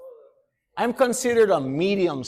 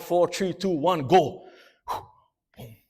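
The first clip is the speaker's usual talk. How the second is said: ordinary delivery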